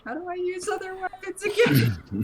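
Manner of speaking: high-pitched, playful self-mockery; comic high voice of someone who forgot; mock cluelessness. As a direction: pitch it way up as if baffled. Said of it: "I'm just like".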